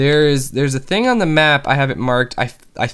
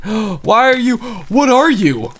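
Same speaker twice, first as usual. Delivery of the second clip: much higher, slowed surprised gasp; startled gasp; 8 semitones higher, slower than usual. Real, not acted. Gamer spotting a flaming mob, startled gasp. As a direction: gasp at something bizarre.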